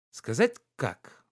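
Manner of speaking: slow, sly, teasing question; drawn-out coy tease. A narrator's reading. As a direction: dangle the secret playfully. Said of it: "лукаво дразнила она".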